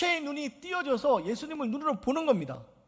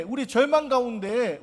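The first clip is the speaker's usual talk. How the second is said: ordinary delivery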